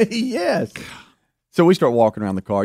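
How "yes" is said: high-pitched, laughing yes; cracking up while agreeing; laughing agreement. Real, not acted. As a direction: agree through real laughter. Laughing agreement with washboard joke.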